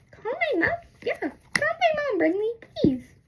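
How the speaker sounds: high, whiny begging; mock pleading. Stuck girl begging for mom, high mock whine. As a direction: beg in a high mock-crying voice.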